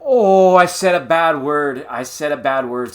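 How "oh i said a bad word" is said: loud, slow, high gasp of fake dismay; mock shocked. Caught swearing on the livestream, hammed-up dismay. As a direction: clutch your pearls over your own swearing.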